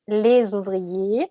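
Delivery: Les ouvriers drawn out, z linking clearly voiced; slow liaison z. Shown as a demonstration. Teacher repeating the liaison for the student, slow. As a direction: model the z liaison slowly. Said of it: "on ne le prononce pas".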